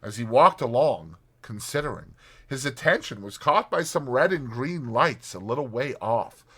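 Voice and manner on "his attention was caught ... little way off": plain reading voice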